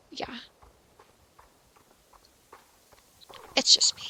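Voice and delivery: whispered admission to herself; sheepish whisper. Real, not acted. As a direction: breathe it out, unvoiced. Admits her mistake to herself.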